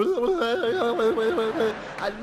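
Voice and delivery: long, loud wobbling gibberish; comic wordless noise of falling apart; shattered babble. Acted. Husband showing how shattered he is, loud gibbering. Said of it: "I'm just".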